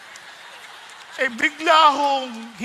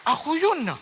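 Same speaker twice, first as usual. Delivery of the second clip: normal talking voice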